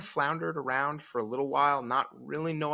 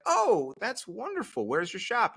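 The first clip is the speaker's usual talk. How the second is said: mock delight; 10 semitones higher, slower than usual. Feigned delight at stranger.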